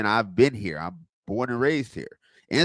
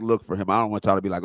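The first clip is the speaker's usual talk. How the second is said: usual speaking voice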